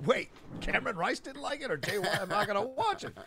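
mock fan voice. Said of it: "millions of people that go".

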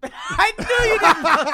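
loud, high and drawn out through laughter; slow, high shout bursting with laughter; laughing outburst. Real, not acted. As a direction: crack up while calling out a friend. Cracks up catching friend's invented fact.

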